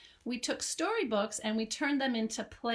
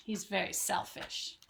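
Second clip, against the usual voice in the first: ordinary delivery, nothing marked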